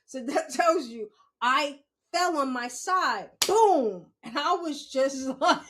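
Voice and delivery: ordinary talk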